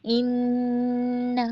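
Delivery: इन्ना with held nasal nn, slow; tajweed demo. Shown as a demonstration. Teacher models इन्ना with long nasal nn. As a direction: stretch the nn of इन्ना with nasal ghunna. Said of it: "मद्दे मुनफसिल का कायदा है".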